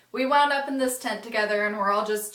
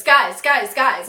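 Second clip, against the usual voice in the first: loud urgent call to the group; loud repeated call, fading on the last; 10 dB louder, slower than usual. Retold or imitated. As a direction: burst in calling everyone's attention. Burned boy barges into tent.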